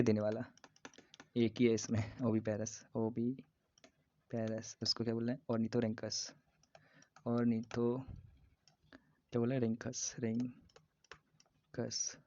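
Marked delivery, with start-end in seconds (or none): 2.9-4.8 oviparous split into long pieces; slow dictation. Shown as a demonstration. Teacher repeats the egg-layer term for students, very slowly. 10.2-12.2 रिंकस in two parts with a long gap; slow dictation. Shown as a demonstration. Teacher repeats end of platypus name for students, very slowly.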